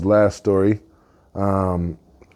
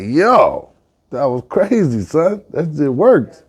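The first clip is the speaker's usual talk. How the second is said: higher-pitched, thrilled disbelief; amazed excitement; 7 semitones higher, 3 dB louder, slower than usual. Acted. Turkey amazed it flew up a level, excited higher voice. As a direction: play up the amazement, pitch raised.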